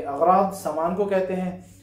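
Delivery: ordinary talk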